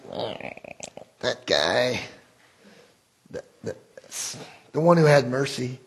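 quiet, hesitant, forcing the words out; reluctant grudging answer. Retold or imitated. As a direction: barely choke out the answer. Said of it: "he could barely choke it out".

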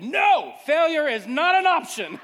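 high, loud laughing shout of defiance; defiant shout. Retold or imitated. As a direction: bellow it out while rowing, laughing. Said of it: "I said".

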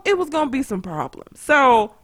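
normal talking voice

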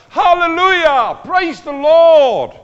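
high, very loud acclamation; joyful praise shout. Shown as a demonstration. Preacher showing what murmuring is not, jubilant shout. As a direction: shout it out in praise, stretching the last word. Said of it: "It's not a sound like".

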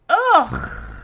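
loud, drawn-out ugh; disgusted groan. Real, not acted. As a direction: groan in disgust at his nerve. Disgusted reaction to the flirting.

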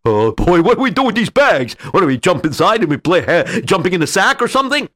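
high, fast dopey crook; goofy sidekick voice. Acted. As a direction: babble like a silly henchman. Dim thief sidekick voiced.

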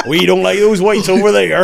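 put-on African accent, pushed loud.

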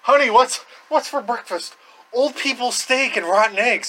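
hammed-up domestic cheer; mock cheery spouse. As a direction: call to a pretend spouse. Acted family breakfast scene.

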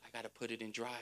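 very low, hushed aside; sheepish realization. Acted. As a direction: drop to a quiet, deadpan admission. Driver's sheepish realization after panic.

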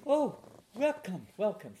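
imitating a woman's high welcome; welcoming devotee. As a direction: greet a visitor with exaggerated delight, high-pitched. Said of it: "say it in a nice French accent".

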